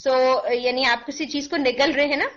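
normal talking voice

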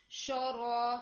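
شورا with a rounded shu; wrong shu sound. Shown as a demonstration. Teacher shows the wrong shu in شورا. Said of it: "شو کی sound آ گئی تھی".

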